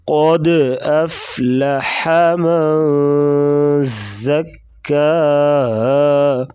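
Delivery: قد with heavy ق and bouncing د, nasal من; slow chant with qalqala on قد; tajweed recitation. Shown as a demonstration. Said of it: "قاف کو موٹا کریں گے".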